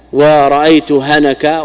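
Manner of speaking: usual speaking voice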